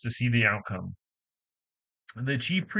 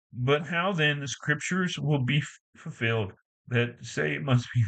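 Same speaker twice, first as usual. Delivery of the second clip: ordinary delivery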